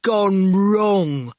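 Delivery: gloomy put-on character voice; dismal. A narrator's reading. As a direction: sound dismally resigned to failure. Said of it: "Erskine said dismally".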